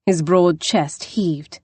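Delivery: plain reading voice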